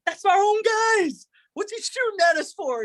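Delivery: seeing his own guys firing at him; outraged disbelief. Retold or imitated. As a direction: squeal it in disbelief. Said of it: "I'm like".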